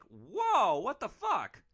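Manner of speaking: high, loud startled exclamation; shocked. Retold or imitated. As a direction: caught totally off guard. Driver's inner shocked reaction.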